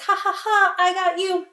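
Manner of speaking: high, spoken ha-ha-ha gloat; mock laughing taunt. Peter jeers at the fooled villagers, mock laughing. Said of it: "he's laughing".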